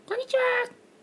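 squeaky falsetto greeting; exaggerated sky-high pitch. Shown as a demonstration. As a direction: call hello in an absurdly high falsetto. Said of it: "そこまで高くないですよ".

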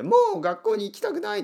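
高い声で、すねた中学生のように; 顔を赤くした中学生をまねて; 恥ずかしがる中学生のまね. Retold or imitated. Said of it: "って思うような". (高い声で中学生の嘆きをまねる)

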